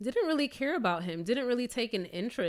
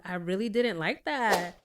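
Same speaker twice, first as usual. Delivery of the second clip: ordinary talk, nothing marked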